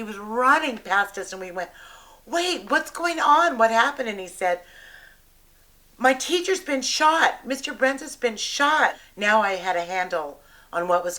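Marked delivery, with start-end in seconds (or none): 2.3-4.1 high, urgent retold questions; alarmed questioning. Teachers calling to a colleague running past, alarmed. 6.0-9.0 high, loud retold alarm; distraught cry. Running teacher reporting a shooting to colleagues, distraught.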